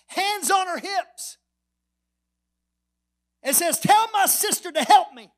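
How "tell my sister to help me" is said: loud, high, exasperated outburst; acted indignant yell; worked-up demand. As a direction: burst out loudly as a fed-up sister. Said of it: "Martha gets all worked up".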